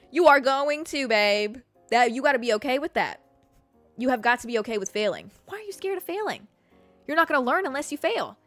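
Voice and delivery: normal talking voice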